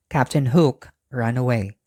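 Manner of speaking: usual speaking voice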